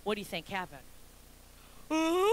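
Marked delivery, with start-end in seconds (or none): 1.9-2.3 loud, rising, drawn-out mock wail; mock toddler wail. Mom imitating her toddler refused a brownie, loud mock wail. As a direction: imitate a child bursting into tears.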